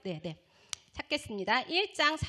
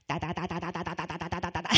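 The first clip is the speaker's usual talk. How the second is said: low, monotone rattle of one syllable; babbled tongues; 6 semitones lower than usual. Retold or imitated. Student's turn at speaking in tongues, babbling. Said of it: "이렇게 했어요".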